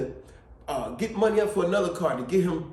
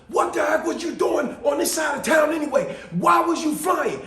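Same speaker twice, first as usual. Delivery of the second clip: high, loud angry dad shout; father yelling at daughter about the wrecked car, loud and high; 9 semitones higher, 4 dB louder than usual. Retold or imitated. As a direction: yell at your daughter like a furious father. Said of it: "the father might even yell at his daughter".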